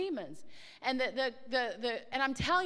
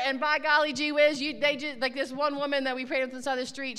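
usual speaking voice

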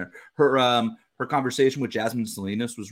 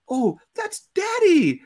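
high, drawn-out squeal of delight; excited child; 4 dB louder, much slower than usual. Retold or imitated. Young son spotting his daddy in the picture, excited. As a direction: imitate a thrilled little kid, very high and slow. Child's reaction retold by parent.

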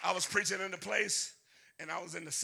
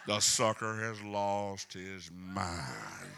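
slow, low put-on grumble; very slow low grumbling voice; mock member; 9 semitones lower, much slower than usual. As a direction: drag it out low like a disgruntled member. Voicing the church member.

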